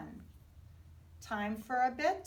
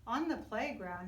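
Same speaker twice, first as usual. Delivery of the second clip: ordinary delivery, nothing marked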